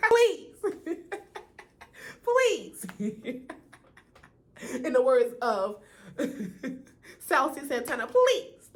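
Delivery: squealing please through laughter; laughing. Real, not acted. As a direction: laugh hard while pleading. Laughing while begging celebrities.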